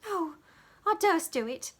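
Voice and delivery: put-on high, loud girlish protest; too scared to come in; little maid refusing out of fear of Miss Sally; frightened small servant. A narrator's reading. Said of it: "rejoined the small servant".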